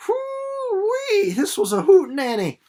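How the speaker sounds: high, loud whoop of delight; teacher whooping to the class after the exciting chapter; excited whoop. Real, not acted. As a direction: holler it like the chapter was a wild ride.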